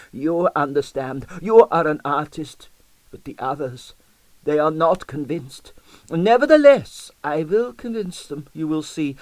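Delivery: high Russian-lilted voice, sad then rising to defiance; sigh, then vow to win them over; sorrowful old Russian dancer. Acted. Old dancer to the detective, sorrowful then defiant.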